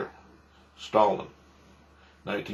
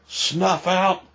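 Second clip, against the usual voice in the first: high, squeaky incredulous echo of snuff out; mocking disbelief; 8 semitones higher than usual. Ridiculing the speaker's wording, high mocking disbelief. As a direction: parrot the phrase as if it were absurd.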